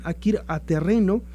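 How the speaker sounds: usual speaking voice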